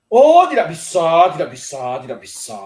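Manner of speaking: mock-smug no, sinking to almost nothing. Teasing refusal to buy coffee.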